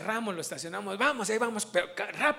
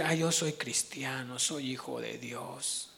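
smug complacency; 7 semitones lower, 6 dB quieter, slower than usual. Retold or imitated. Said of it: "De decir".

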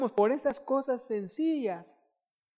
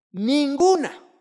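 emphatic; 7 semitones higher, 11 dB louder, much slower than usual. Real, not acted. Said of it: "no excuse emphasis".

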